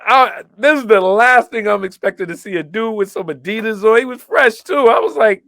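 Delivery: high, animated replay of disbelief; retold amazement. Acting out his surprise back then.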